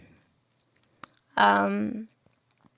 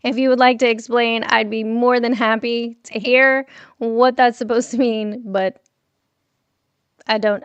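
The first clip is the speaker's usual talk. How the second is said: normal talking voice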